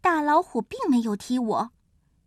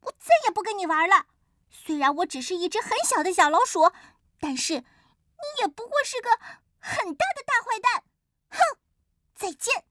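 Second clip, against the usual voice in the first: high-pitched indignant little mouse; acted angry squeal without much volume; angry outburst; 6 semitones higher than usual. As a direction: cry out in a storybook huff, pitch raised. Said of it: "我气得大叫起来".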